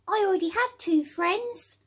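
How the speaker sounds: high, small creature voice; squeaky little critter saying no. A narrator's reading. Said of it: "replies the little creature".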